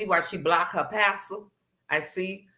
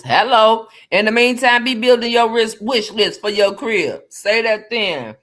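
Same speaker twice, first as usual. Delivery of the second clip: loud, higher hype call; 4 semitones higher, 8 dB louder than usual. Real, not acted. Cheering Chris on to her viewers, loud hype call. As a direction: call it out big to the crowd.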